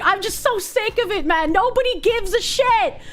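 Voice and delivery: exasperated rant. Real, not acted. Venting about transphobia accusations.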